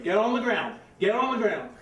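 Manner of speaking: loud barked command, get on the ground; yelling gunman. Retold or imitated. Gunman yelling at a suspect in the lot, loud commands. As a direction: shout it like a man holding a gun.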